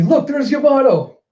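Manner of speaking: high, loud pointing call; excited spotting. Retold or imitated. Onlooker spotting Yamato, calling high and loud. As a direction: point him out eagerly. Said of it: "And you see".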